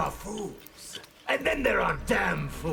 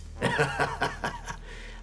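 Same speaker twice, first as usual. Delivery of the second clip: menacing chuckle. Acted. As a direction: chuckle darkly, amused by the insult. Villain amused at being called infamous.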